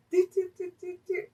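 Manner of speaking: high, quiet staccato do-do-do; tiny high-pitched noises imitating someone passing by; soft, high mimed sound effect; little "do-do" noises. Miming her walking past staring.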